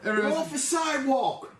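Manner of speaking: high, loud, slow New York cry; hammed-up New York accent. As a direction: shout like a cartoon New Yorker.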